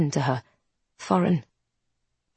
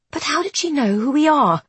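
put-on young woman voice; 8 semitones higher than usual. A narrator's reading. Isby voiced.

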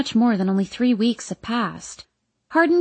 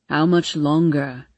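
low, slow grumble; lowered voice for an impatient complaint; complaining Hardin; 6 semitones lower, slower than usual. A narrator's reading. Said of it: "Hardin complains".

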